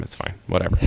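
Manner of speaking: ordinary delivery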